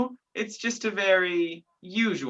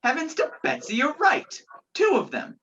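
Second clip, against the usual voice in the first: much higher-pitched amazement; astonished; 9 semitones higher than usual. Acted. Man at the window sees two men watching, astonished. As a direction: exclaim in startled disbelief, pitch jumping up.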